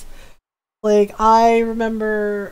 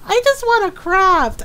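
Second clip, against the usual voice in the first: whiny plea; 11 semitones higher, 5 dB louder than usual. Retold or imitated. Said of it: "I'm just like".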